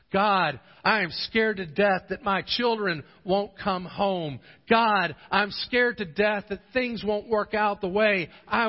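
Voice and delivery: loud, high, building cries of fear; desperate prayer building. Acted. Preacher voices a fearful prayer.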